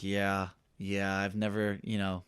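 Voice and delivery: low, soft, hesitant reply; acting out a timid first-timer; sheepish admission. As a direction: retell it small and embarrassed, trailing away. Sheepish first-timer's answer, retold.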